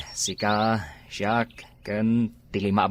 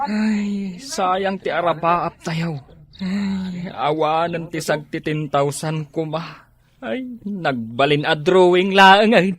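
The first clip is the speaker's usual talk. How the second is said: lament; 5 semitones higher, much slower than usual. Acted. Friend lamenting failed dream.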